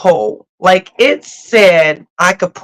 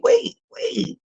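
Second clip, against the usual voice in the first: squeaky wait; 10 dB quieter than usual. Retold or imitated. Retold call to driver.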